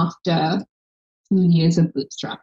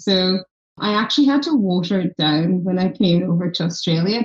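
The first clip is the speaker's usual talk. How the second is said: ordinary delivery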